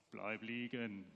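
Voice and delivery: low, slow, soft coaxing; voice of feeling. Acted. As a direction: tempt a sleeper to stay in bed, low and lazy. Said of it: "Mein Gefühl sagt". (tiefe, leise, träge Stimme des Gefühls)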